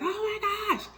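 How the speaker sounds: very high, dismayed gasp of sympathy; shocked oh my gosh. Retold or imitated. Colleague weeps in her office, shocked sympathy. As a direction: heart breaking at his tears. A guy bursts out crying.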